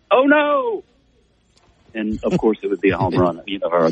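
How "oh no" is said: mock top-of-the-lungs yell, pitched way up; imitated yell. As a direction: yell it out like a coach seeing trouble coming. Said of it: "he's yelling almost at the top of his lungs".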